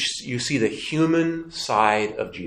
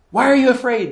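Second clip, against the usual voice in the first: higher, louder rebuke to frightened disciples; a sharp, raised rebuke; rebuking Jesus; 10 semitones higher, 6 dB louder than usual. Acted. Jesus rebukes his frightened disciples, raised and sharp.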